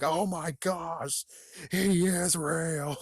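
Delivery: high, drawn-out mock panic; slow high wail of disbelief; freaking out. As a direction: stretch each word in hammed-up alarm. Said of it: "freak out even more".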